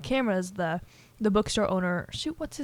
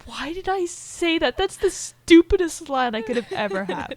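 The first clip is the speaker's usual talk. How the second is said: slower, higher cringing at himself; self-scolding; 8 semitones higher, 4 dB louder than usual. Retold or imitated. William alone, scolding himself, mildly higher. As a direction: groan inwardly at the dumb line. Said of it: "he's like".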